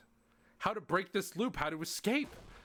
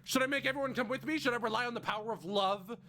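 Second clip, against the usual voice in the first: high, pressing, frantic questions; 8 semitones higher than usual. Acted. Siffrin begging Loop for answers, frantic and high. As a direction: pile the questions on, desperate.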